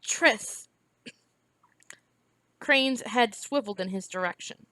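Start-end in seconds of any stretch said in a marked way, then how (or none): 0.0-0.7 a croaked call of Tris; rough, strained call of a friend's name; boy croaks out his friend's name. A narrator's reading. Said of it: "Briar croaked".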